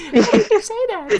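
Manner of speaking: high, loud words through real laughter; laughing protest. Real, not acted. Streamer cracking up at a viewer's meme, laughing protest. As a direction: protest while cracking up, voice shooting up.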